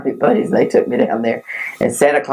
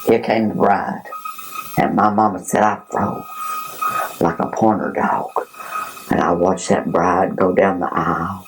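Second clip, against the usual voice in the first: ordinary talk, nothing marked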